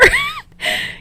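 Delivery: squealing burst of laughter; high wild laugh. A narrator's reading. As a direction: howl with laughter. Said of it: "laughs uproariously".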